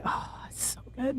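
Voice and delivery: very soft, whispered delight; savouring whisper. Acted. Fan relishing chocolate from the freezer, whispered.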